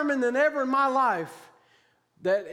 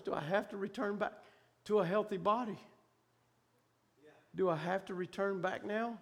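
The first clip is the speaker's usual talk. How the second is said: soft, low, halting question; subdued retold pleading; hushed plea; 4 semitones lower, 8 dB quieter than usual. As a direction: plead quietly, pausing between phrases. Retold plea not to return.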